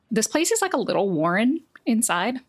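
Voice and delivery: usual speaking voice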